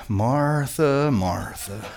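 low, soft, gently chiding; patient Jesus. Acted. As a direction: sigh the name softly, dropping low. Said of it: "he goes".

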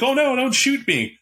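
squeaky high mock plea, hammed up; mock pleading. GM mocks player's surrender, high mock plea. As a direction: play a coward begging the cops not to shoot. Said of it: "be like".

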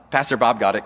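ordinary talk

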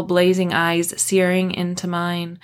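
ordinary delivery

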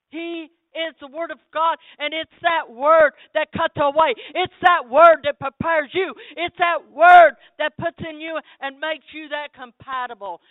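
loud, high hammering sermon; preaching at full force. Acted. Preacher exhorting the congregation, loud and fervent. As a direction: drive each line home.

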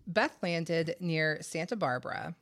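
normal talking voice

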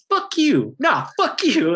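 high, jeering "fuck you" at the crowd; mock taunt. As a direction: jab it at them like a cocky heckler.

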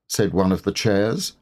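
usual speaking voice

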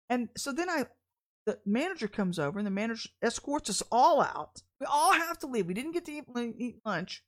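normal talking voice

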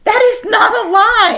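very high, loud, drawn-out protest; squealed denial; indignant shout. Real, not acted. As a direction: yell it back slowly in outrage. Arguing back at accusation of lying.

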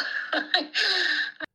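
a high, moved laugh of relief; relieved high laugh; grateful laugh. Real, not acted. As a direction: let out a happy laugh. Mother laughs after grateful.

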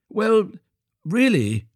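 high, flustered exclamation; Hans crying out. A narrator's reading. Hans touched by the miller's question, high flustered cry. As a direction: exclaim in a high, eager little voice. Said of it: "cried Hans".

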